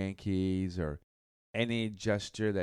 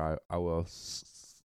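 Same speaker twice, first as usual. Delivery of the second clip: stuttering, stuck on the s; real stutter, catching on the first sound; 3 semitones lower, 3 dB quieter than usual. Real, not acted. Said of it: "like I just did right there".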